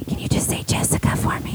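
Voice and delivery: whispered ask for a favour; whispered request. Retold or imitated. Asking celebrity quietly at signing.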